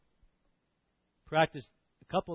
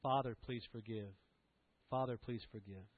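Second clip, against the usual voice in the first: quiet breath prayer; 3 semitones lower, 7 dB quieter than usual. Shown as a demonstration.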